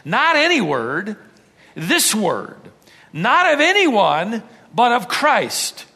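preaching. Acted. Preaching at full force.

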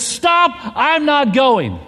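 high, loud and drawn-out slowly; panicked refusal. Retold or imitated. Son refusing the push off the edge, high drawn-out yell. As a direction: stretch every word of a scared kid's refusal.

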